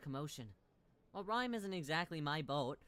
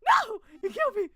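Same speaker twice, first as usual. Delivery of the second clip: high, loud cry of defeat; anguished shout; wailed protest; 17 dB louder than usual. Real, not acted. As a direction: yell it as a wounded accusation. Streamer's character is killed.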